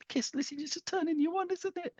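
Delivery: high, soft suggestive tease; teasing. Acted. Teasing a viewer about the kilt, high and hushed. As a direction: coo it out like a flirty taunt.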